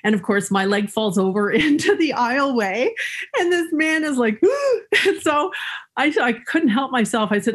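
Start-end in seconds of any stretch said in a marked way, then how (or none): 4.5-5.0 sharp, high mock gasp of surprise; imitated shocked gasp. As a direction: mimic the startled man's sudden intake of breath. Man shocked by falling leg.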